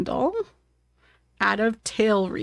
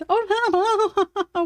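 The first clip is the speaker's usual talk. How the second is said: alarmed oh no; 10 semitones higher than usual. Real, not acted. Cat heads for wet paint.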